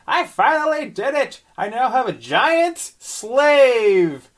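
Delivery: high, slow, gloating witch; cackling crone, drawn out; old hag. Acted. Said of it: "Pickle the Witch was shouting".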